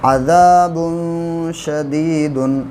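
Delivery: slow tajweed recitation of अज़ाबुन शदीदुन; Quranic recitation. Shown as a demonstration. Teacher demonstrating tajweed to students, slow recitation. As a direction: draw out अज़ाबुन शदीदुन, tanween clearly joined. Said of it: "दो पेश की तनवीन के बाद शीन आया".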